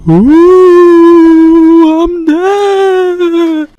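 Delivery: sustained high, loud death wail. Acted. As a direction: hold a long high note of dying. Dramatic death line.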